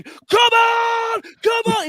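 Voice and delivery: high excited shout of come on. Retold or imitated. Son running up the aisle after the penalty, shouting. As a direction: yell it like a fan celebrating a penalty. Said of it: "ran up and down the aisle going".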